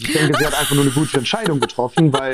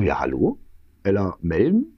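low, questioning, rising on each phrase; tentative phone greeting; 6 semitones lower than usual. Retold or imitated. Calling the unknown number next morning, tentative and puzzled. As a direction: feel out who is on the line, pitched down.

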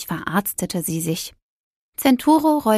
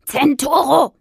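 higher, louder shout of the name; loud call; 6 semitones higher, 5 dB louder than usual. A narrator's reading. Witch calling loudly for her missing cat, shouting. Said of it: "rief sie noch einmal laut".

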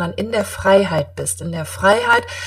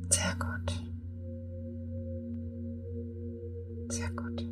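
guided meditation; 9 dB quieter than usual. Acted.

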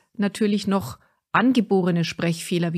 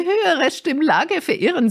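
strained-up higher pitch, swooping tune; unpleasantly high register; 9 semitones higher, 4 dB louder than usual. Shown as a demonstration. Coach showing listeners an unwanted higher register, high-pitched. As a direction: let the voice stray up too high. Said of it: "Und ihr hört schon, wie unangenehm das dann klingt".

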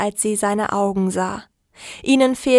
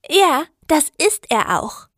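lightly voiced young woman, higher; a touch higher, eager girl; 7 semitones higher than usual. A narrator's reading. Sarah voiced in story.

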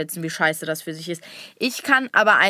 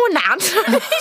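a burst of high laughter; 8 semitones higher than usual. Real, not acted. Daughter, retelling her brother's phone ban, bursts out laughing.

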